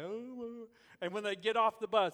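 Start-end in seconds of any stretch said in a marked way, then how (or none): 0.0-0.7 put-on whining kid. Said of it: "just whine the whole way".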